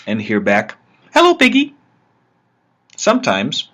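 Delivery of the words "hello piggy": much higher, louder squeaky cartoon pig echo; high cartoon pig repeating the man's hello; funny pig voice. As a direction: parrot the greeting back as a silly virtual pig. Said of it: "in a funny voice".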